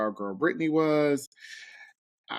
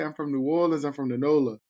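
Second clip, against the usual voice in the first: put-on New Orleans accent; 3 dB louder than usual. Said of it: "what do they say?".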